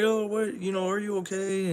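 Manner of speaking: high, fussing over him; a hammed-up worried voice, way up high; mock concerned girl. Girl fussing over the injured hero, high and worried. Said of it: "she's like".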